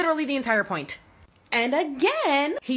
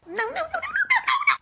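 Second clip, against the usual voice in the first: emphatic refusal; faster than usual. Real, not acted. Cutting off dad's claim.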